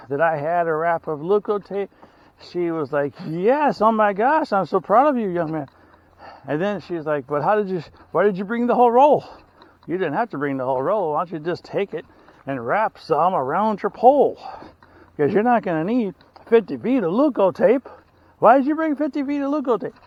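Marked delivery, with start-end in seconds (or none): 3.3-5.7 gushing praise. Retold or imitated. Older hiker lady praising him. 7.3-19.9 incredulous teasing. Retold or imitated. Lady scolding about whole roll.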